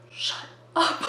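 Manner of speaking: whispered telling-off; imitating a kid's urgent whisper; hushed command. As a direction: whisper it sternly to silence someone. Tall kid hushing her.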